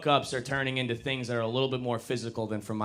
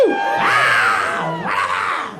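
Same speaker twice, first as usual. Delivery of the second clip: cheering shout; 3 semitones lower, 9 dB louder than usual. Acted. Crowd-style cheer.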